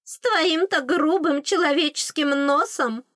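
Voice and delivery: high, slow scorn in a fairy voice; mock her in a lofty little voice; disdainful fairy. A narrator's reading. Fairy belittles the human nose, high disdain. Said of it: "пренебрежительно фыркнула фейка".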